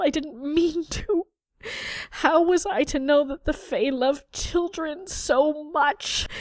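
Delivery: high, slow, tearful lament; a broken boy sobbing out his confession, slowly; sobbing, pleading boy. A narrator's reading. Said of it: "Liam only sobs harder".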